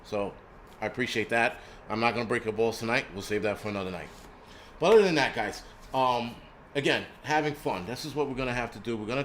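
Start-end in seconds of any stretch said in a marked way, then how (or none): none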